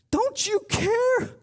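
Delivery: very high, slow mock wail of reproach; upset, indignant demand. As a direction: stretch the accusing question way up high. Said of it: "she gets upset".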